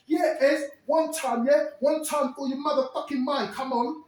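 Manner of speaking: high, loud, manic voice; Terry wound up. Retold or imitated. Terry answers Ez, high and manic. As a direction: wind him up, manic and loud. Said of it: "he's like".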